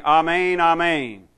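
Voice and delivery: "Amen, amen" rung out as a solemn opener; emphatic declaration. Retold or imitated. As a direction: proclaim "Amen, amen" with equal force on each. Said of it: "to show emphatically this is a true statement".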